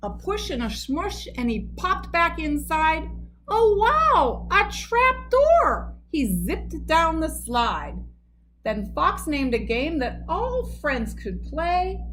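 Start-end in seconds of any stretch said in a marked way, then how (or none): 3.5-5.9 high, loud and slow; delighted wow; excited. A narrator's reading. Moose delighted by trap door.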